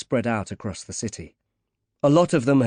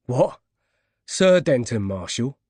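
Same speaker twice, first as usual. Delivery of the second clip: put-on Cockney accent; 3 dB louder than usual. A narrator's reading. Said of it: "In a thick Cockney accent".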